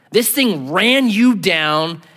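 each word slowed and weighted, "you" stressed; slow emphatic restatement. Shown as a demonstration. Preacher repeats a phrase slowly to drive it home, slow and weighted. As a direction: drag out "This thing ran you down" for weight. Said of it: "or literally it ran you down".

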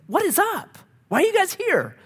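very high, exaggerated bafflement; baffled. Counselor pressing a seemingly perfect couple, comically baffled. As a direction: squeak it out in mock disbelief.

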